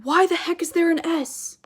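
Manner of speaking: puzzled student. Pretend. Teacher voicing a confused student asking about the S. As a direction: voice the viewer's baffled question. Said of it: "You're probably wondering".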